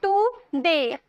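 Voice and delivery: "टुडे" split in two, टु then डे; syllable by syllable. Shown as a demonstration. Teacher counting two syllables for students, टुडे said in two beats. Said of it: "दो syllable आए ना".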